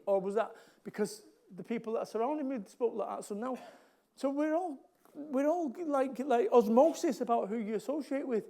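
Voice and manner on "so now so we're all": usual speaking voice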